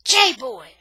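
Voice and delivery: loud shout of a name; yelling. A narrator's reading. Boy running after J-Boy, yelling. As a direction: holler to get his attention. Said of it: "I'm yelling".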